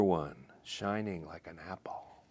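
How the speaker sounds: unvoiced hush on the last line of the poem; whisper. Poet closes the apple's plea in a hush. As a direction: breathe it out softly, no voice.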